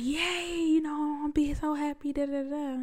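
high, gushing mock glee over the new car; mock happy gush. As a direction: play up a giddy fake joy, light and airy. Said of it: "I was like".

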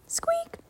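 a very high, whispered squeak; tiny mouse squeak. Acted.

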